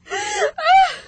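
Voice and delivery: high waking cry; startled scream. Acted. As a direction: jolt awake screaming. Wakes with a cry.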